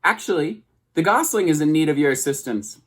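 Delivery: high, bright booming robot greeting; friendliest robot voice. A narrator's reading. Robot calling across the pond to the goose, high and cheerful. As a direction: boom it out cheerfully across the water.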